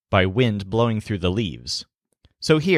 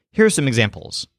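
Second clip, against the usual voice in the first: usual speaking voice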